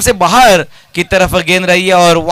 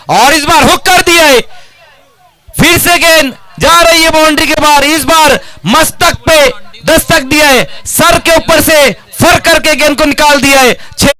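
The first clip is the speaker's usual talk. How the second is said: excited shouting commentary; 7 semitones higher than usual. Real, not acted. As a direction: build to a roaring shout. Live call of a six.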